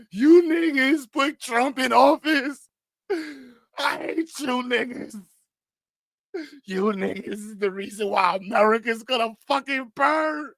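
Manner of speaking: high, slow furious rant voice; D L Hughley crashing out. Retold or imitated. D L Hughley raging online at Trump voters, high slow fury. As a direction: rant at voters like you've lost it. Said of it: "he's online crashing out".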